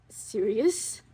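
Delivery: ordinary talk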